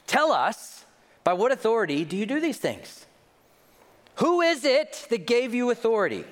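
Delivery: high, loud demanding priests; indignant challenge. Acted. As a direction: confront him, pushy and affronted. Priests confronting Jesus, acted.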